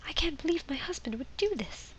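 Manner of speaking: hushed, desperate prisoner pleading; whispering prisoner. Acted. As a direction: beg for help in a whisper. Voices imprisoned man from game.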